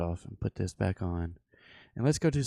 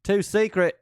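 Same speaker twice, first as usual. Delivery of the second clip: high, loud "Too secret" in a put-on voice; mock accent, hammed-up; 10 semitones higher, 4 dB louder than usual. Teasing chat about secret charts, hammed-up mock accent. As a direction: tease the viewers with a silly voice. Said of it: "I won't go deep into them".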